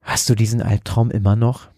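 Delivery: plain reading voice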